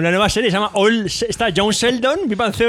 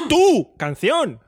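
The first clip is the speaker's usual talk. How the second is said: «tu» fuerte y alargado, como pronombre; acento en TÚ; 4 semitones higher, much slower than usual. Shown as a demonstration. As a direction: golpea «tu» y sepáralo de «canción». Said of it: "con acento en la U". («tu» tónico, despacio, como llamando a alguien)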